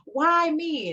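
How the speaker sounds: high-pitched mock wail of self-pity; retold crying. Recalling her days of screaming and crying while healing, retold crying. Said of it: "I would be crying".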